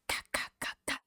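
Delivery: breathy imitated knock on glass; whispered knocking sound. Man knocking on car window.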